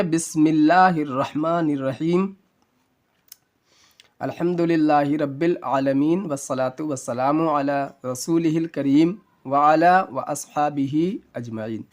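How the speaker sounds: slow, measured Arabic invocation; slow pious recitation. Acted. Teacher opening the Urdu lesson with a blessing, slow recitation. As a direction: recite it devoutly and unhurried.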